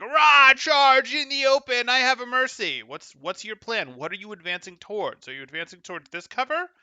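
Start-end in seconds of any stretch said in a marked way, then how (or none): 0.0-2.8 loud, high mocking war cry; mock battle charge. Coach mimics the player rushing into the open, mock battle cry. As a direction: imitate a reckless charger, hollering slowly. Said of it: "you're just like".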